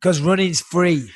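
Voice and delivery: said through a laugh, slowly; chuckling as you deliver the joke; laughing punchline. Retold or imitated. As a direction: giggle out the punchline.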